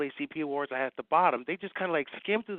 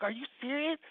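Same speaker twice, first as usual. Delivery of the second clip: incredulous; 10 semitones higher than usual. Retold or imitated. Retold disbelief on the phone.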